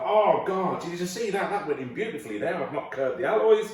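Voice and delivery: high, delighted boast; mock thrilled driver; proud excitement. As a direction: gush over a perfect parking job. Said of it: "and go".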